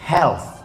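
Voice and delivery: "Health" with the TH clearly sounded; TH sound shown. Shown as a demonstration. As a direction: say "Health", ending tongue-between-teeth TH. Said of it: "Ada huruf tha dalam bahasa Inggris?". ("Health" dengan bunyi tha yang jelas di akhir)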